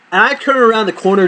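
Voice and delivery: usual speaking voice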